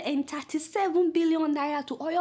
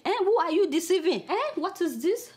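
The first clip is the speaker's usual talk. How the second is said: ordinary delivery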